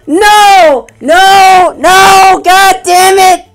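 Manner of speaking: loud, high wails of refusal; anguished screaming. Real, not acted. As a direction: scream each word in frustrated disbelief. Character dies in game.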